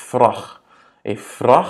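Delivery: VRAG with a short, O-like A; short vowel. Shown as a demonstration. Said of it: "c'est un A court".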